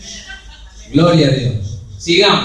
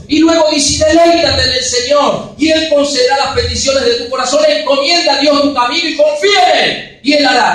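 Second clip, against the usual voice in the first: loud, rising scripture proclamation; fiery preaching; 3 semitones higher, 6 dB louder than usual. Acted. Pastor proclaiming the psalm to his flock, loud and fervent. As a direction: proclaim the verse to the back row.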